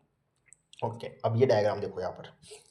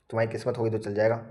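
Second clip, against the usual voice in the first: ordinary talk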